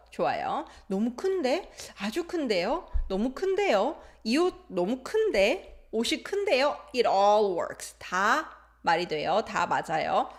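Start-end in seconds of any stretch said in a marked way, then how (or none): 0.9-6.8 큰데 lifted at the end like a question; higher, with each 큰데 curling upward; questioning rise on every 큰데; rising intonation. Shown as a demonstration. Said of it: "always used with rising intonation".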